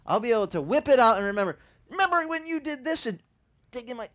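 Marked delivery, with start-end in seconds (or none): 1.9-3.1 high, needling grudge voice; gloating reminder; mock accusing. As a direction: throw it back at them, pointed. Sermon, acting out a grudge-keeper.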